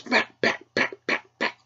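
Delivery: light spoken thumping sounds; mimicked whacks. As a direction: tap out each blow. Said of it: "and he goes".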